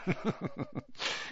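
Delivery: soft, high breathy chuckle; light self-satisfied titter; little chortle. A narrator's reading. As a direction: chortle smugly to yourself. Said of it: "he gave a little chortle".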